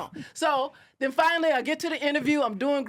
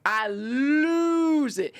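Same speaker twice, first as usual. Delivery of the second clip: losing it; 2 semitones higher, much slower than usual. Real, not acted. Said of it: "I lose my stuff".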